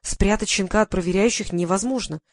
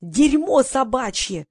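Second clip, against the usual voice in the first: high, drawn-out angry holler; narrated wail of outrage; yelling; 5 semitones higher, much slower than usual. A narrator's reading. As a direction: stretch each word into an indignant yell. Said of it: "завопил Вадим".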